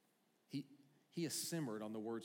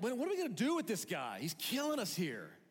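high, loud, fast alarmed voice; high, loud mock panic; panicked Roman; 9 semitones higher, 7 dB louder than usual. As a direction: play a Roman official at his wits' end. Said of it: "And you're like".

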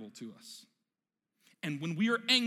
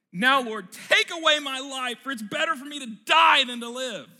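temper tantrum; 6 semitones higher, 11 dB louder than usual. Acted. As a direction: throw a toddler's tantrum at God. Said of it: "this is a three-year-old temper tantrum".

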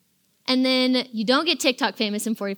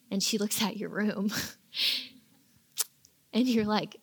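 normal talking voice

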